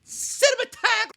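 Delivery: very high, drawn-out squawk of "cinematog-"; goofy word-stumble. Real, not acted. Reviewer tripping over cinematography, goofy high squawk. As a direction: blurt "cinematog-" way up high, stretched.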